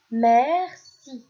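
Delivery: "merci" projected to the class, falling tune; loud model word. Shown as a demonstration. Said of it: "Je répète".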